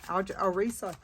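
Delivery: talking through hiccups; interrupted by a hiccup. Real, not acted. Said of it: "I got the hiccups".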